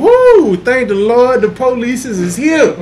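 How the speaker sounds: loud, high, sarcastic cheer of relief; mock relief. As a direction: ham up fake gratitude at the cops. Mocking relief at police.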